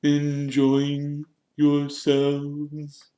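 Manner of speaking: very slow, high ghostly voice; Nearly Headless Nick. A narrator's reading. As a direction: ask in a drawn-out, wavering ghost voice. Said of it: "Nearly headless Nick now drifted toward them".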